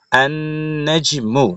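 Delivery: আন held on a humming n, then নেজ মু; nasal ghunnah. Shown as a demonstration. Teacher joining the spelled word, nasal held n. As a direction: linger nasally on আন before নেজ মু. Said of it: "নাকের ভিতরে নিয়ে পড়তে হবে".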